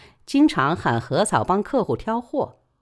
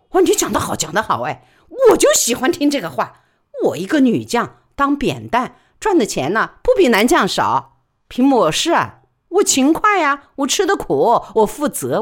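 higher, louder earthy porter woman; loud frank porter woman gossiping; He Sao's voice; 6 semitones higher, 5 dB louder than usual. Acted. He Sao boasts.

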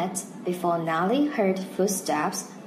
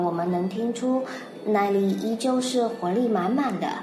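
ordinary talk, nothing marked

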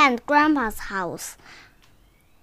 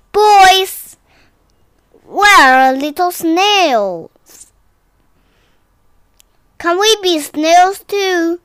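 calling out; 4 semitones higher, 7 dB louder, slower than usual. Acted. Friend calls out loudly.

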